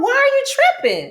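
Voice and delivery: high, exasperated snap of a question. Retold or imitated. To her castmates feeding the drama, high exasperated. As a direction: toss it up high like you can't believe them. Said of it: "in my mind, I'm like".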